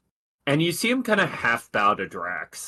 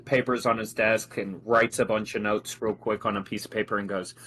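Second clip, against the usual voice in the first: ordinary talk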